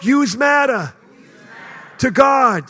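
loud, lightly Brooklyn-flavoured yous; preacher leading crowd in Brooklyn accent. Shown as a demonstration. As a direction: shout it like a New Yorker from Brooklyn. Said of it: "Say it like you're from Brooklyn, New York".

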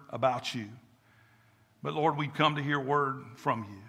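soft, low prayer to God; hushed, even-toned pastoral prayer; public prayer. Real, not acted. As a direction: pray earnestly, letting phrase endings sink quiet. Leads congregation in prayer.

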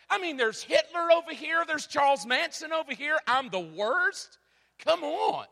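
mock-outraged, hitting worst each time; indignant disbelief. Indignant retold inner reaction.

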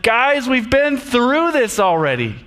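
a tired leader reminding grumblers of miracles; exasperated Moses. Retold or imitated. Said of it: "He's like".

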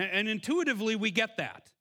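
ordinary talk

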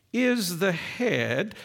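slow, each word weighted "is the head"; stress on is. Shown as a demonstration. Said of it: "it can't be any clearer".

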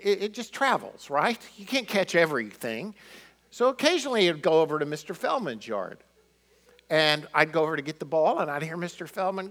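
ordinary talk, nothing marked

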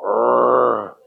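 low comic groan of dismay; mock groan. Preacher acting a man meeting a bear, low mock groan.

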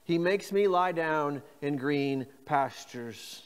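He makes me lie down, slowly; slow reading. Shown as a demonstration. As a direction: lay it out slowly. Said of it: "Say that with me".

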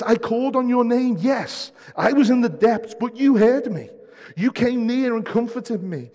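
high, loud impassioned preaching; preaching at full force. Acted. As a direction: lift the congregation with fervent paraphrase. Paraphrases scripture with force.

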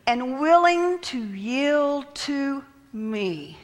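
very slow, stretched-out verse reading; drawn out. Shown as a demonstration. Preacher dwelling on a key verse, drawn out. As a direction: draw every word out slowly.

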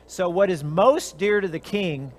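ordinary talk, nothing marked

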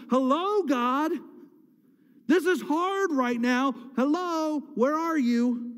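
impatient plea to someone not answering; distressed appeal. Acted. Psalmist feeling abandoned by God, calling out insistently. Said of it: "assuming that God's turned his back".

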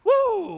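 high, gliding "woo"; imitated whoop down the drop; quick playful thrill cry; ride whoop. Re-enacts going down the flume.

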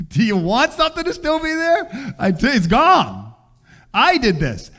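a playful mock reproof from above; God's gentle rebuke. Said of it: "I felt like God quickly".

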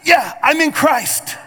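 high, slow, drawn-out declaration; emphatic affirmation. Acted. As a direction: answer with full conviction, stretching it out. Acting a sure answer after doubt.

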